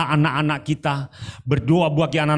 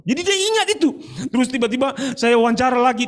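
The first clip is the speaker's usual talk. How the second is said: much higher, bursting excitement; excited high exclamation; 11 semitones higher, faster than usual. Real, not acted. Preacher peaks in retold story. (seruan tinggi yang bersemangat)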